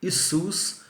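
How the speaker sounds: Isus said slowly, stress on the first i; Romanian Isus. Shown as a demonstration. Teacher demonstrates the Romanian name to students. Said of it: "em romeno fala".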